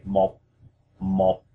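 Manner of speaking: "mo" closed on a stopped P; P sound ending. Shown as a demonstration. Teacher showing learners Vietnamese one, "mo" ending in a P sound. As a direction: say "mo" with the lips shutting on an unreleased P. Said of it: "we say it with a, a P sound".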